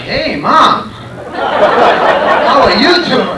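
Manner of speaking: mock Southern reply to mom; Southern drawl. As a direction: drag out how are you doing like a Southerner. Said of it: "And I'm like".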